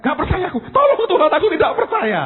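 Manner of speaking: high, loud acted-out plea; desperate cry for help. Father of sick child admitting unbelief to God, desperate cry. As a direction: call out helplessly, voice way up.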